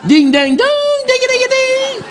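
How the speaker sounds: slow, very high sung ding dong; high bell chime; Quasimodo's bells. Acted. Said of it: "c'est les cloches de Quasimodo".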